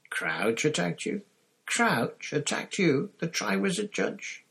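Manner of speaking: slow, high disbelieving repetition; incredulous. A narrator's reading. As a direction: draw it out in astonishment, pitch climbing. Karkaroff incredulous at the accusation.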